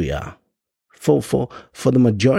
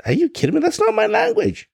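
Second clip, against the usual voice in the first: indignant disbelief; faster than usual. Retold or imitated. Indignant retort to accent critic.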